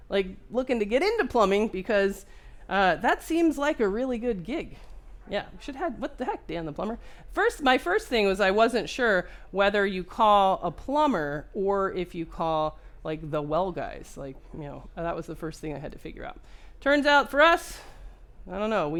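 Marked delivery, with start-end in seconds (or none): none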